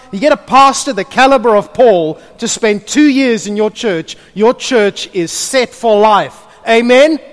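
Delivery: loud, high full-force preaching. Acted. Pastor preaches to the church about Paul, at full force. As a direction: drive the point home to the congregation.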